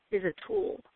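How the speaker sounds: ordinary delivery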